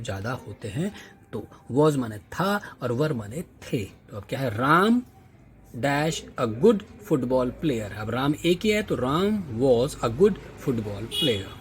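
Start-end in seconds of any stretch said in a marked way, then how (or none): 4.6-7.9 slow reading. Shown as a demonstration. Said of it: "reads the exercise".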